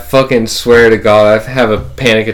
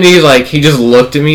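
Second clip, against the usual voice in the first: ordinary delivery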